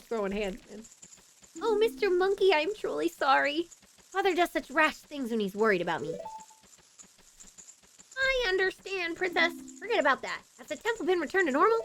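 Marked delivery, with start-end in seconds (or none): 1.6-6.1 lightly voiced courteous princess, starting high; apologetic princess. Princess apologises to the monkey for her father, gently acted. 8.2-11.8 lightly voiced, higher game character; forgiving monkey. Monkey reassures the princess, lightly acted higher voice.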